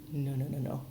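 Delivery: usual speaking voice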